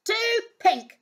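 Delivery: fussy, high princess complaint; high, picky whining exclamation; exclaimed disgust. Acted. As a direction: reject each one with exaggerated disgust. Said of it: "she exclaimed".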